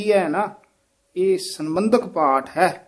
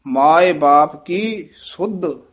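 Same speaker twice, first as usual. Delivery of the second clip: ਮਾਏ ਬਾਪ ਕੀ ਸੁੱਧ very slow and a bit louder; slow teaching read; 4 dB louder, much slower than usual. Shown as a demonstration. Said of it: "ਇੱਥੇ ਵਿਸਰਾਮ".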